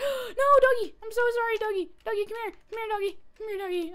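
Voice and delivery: high, loud desperate calling to the dog; panicked shout. Real, not acted.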